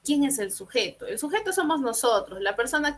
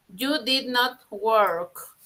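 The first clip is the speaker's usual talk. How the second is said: very slow, words set apart; slow model sentence; much slower than usual. Shown as a demonstration. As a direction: dictate it clearly. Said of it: "Si tú quieres decir".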